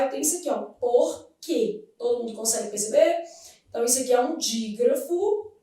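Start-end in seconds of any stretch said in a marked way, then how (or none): 0.6-1.8 "Porque" split into "por... que"; pronunciation demo. Shown as a demonstration. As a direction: say "Porque" in two separate syllables, falling at the end. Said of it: "na hora de pronunciar".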